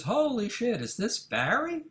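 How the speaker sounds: put-on light up at hearing an old friend; excited surprise. Cliff recognizes old friend on phone.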